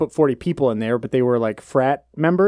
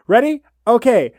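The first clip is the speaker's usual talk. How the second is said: cheerleader; 6 semitones higher, 3 dB louder than usual. Pretend. Cheerleader chant start.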